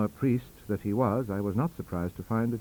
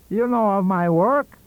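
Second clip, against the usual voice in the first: professor's voice; 10 semitones higher, 10 dB louder, slower than usual. Acted. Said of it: "said he".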